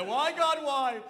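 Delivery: high, loud mock anguish; hammed-up cry of despair; mock wail to God. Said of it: "the crying and the screaming".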